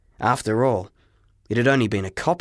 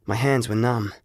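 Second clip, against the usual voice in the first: plain reading voice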